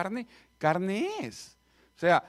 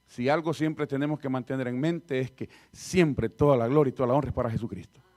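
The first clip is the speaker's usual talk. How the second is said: normal talking voice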